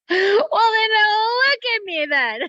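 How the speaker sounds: high, loud defiant comeback; loud laughing retort. Retold or imitated. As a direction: fire it back, half laughing. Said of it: "And I'm like".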